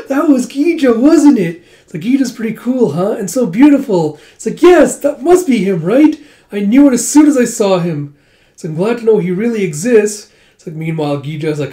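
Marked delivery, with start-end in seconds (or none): 0.0-10.3 much higher and louder, giddy gushing; gushing fangirls. Retold or imitated. Girls gushing excitedly over beautiful Gija, high and loud. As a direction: squeal over the handsome hero.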